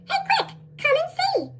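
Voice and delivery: high squeaky little bee voice; helium voice. Acted. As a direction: chirp it up high like a tiny bee. Worker bees call out.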